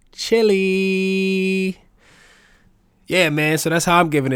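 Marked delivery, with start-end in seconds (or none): 0.1-1.8 high, long-held sing-out; drawn-out comic punchline. Acted. Host joking about cold toilet water, high drawn-out punchline. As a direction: stretch the word out high and held.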